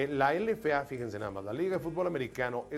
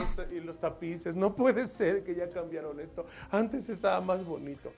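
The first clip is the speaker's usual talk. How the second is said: higher mock-indignant girl voice; girl complaining; 6 semitones higher than usual. Girl revealing the punchline about the carpet, mock indignant. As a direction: complain the room has been redone.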